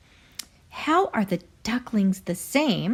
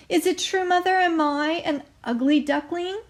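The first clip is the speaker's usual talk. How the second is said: much higher, pleading little duckling voice; small worried duckling; 9 semitones higher, 3 dB louder than usual. Acted. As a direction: ask like a hurt child who fears the answer. Duckling asks mother anxiously.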